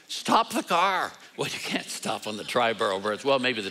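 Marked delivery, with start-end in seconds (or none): 0.1-1.1 high, slow shout of surprise. Retold or imitated. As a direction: cry out to stop the car. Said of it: "I shouted".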